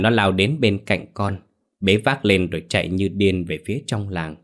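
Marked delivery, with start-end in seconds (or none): none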